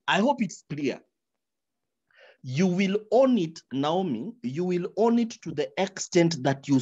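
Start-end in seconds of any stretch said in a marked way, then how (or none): none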